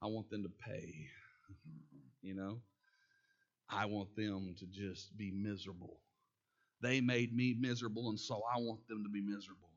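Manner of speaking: low quiet vengeful murmur; bitter inner voice. Retold or imitated. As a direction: mutter it like a grudge you nurse. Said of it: "You ever had that towards somebody".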